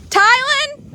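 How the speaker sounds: high, loud call across the yard. Real, not acted. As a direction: shout the name to reach him far off.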